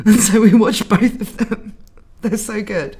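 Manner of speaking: loud laugh spilling into the words; laughing happily mid-sentence. Real, not acted. Laughing while recalling a movie night.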